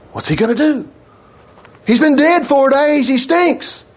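high-pitched scoffing protest; acted-out exasperated objection; mock disbelief. Acting out the doubters at the tomb.

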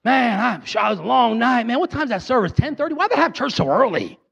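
mock groggy, whiny complaining voice; tired churchgoer. Sleepy churchgoer on Sunday morning, mock whiny grumble. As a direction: grumble like someone who'd rather sleep in. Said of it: "you go".

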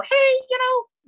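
put-on very high, drawn-out squeaky teen "hey"; pubescent teen voice. Teen self greeting a stranger at first locals, squeaky pubescent voice. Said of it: "for me, barely hitting puberty".

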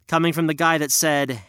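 ordinary delivery

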